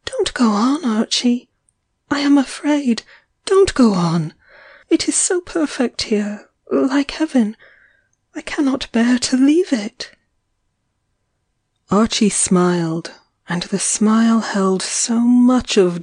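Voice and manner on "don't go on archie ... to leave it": higher, pleading, fearful; frightened plea. A narrator's reading. Eileen, trembling, begs Archie not to go on, higher. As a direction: beg him to stop, afraid. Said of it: "she clung, trembling".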